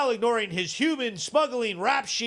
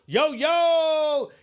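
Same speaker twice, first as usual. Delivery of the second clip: hype greeting; 6 semitones higher, 4 dB louder than usual. Acted. Greeting a guest in.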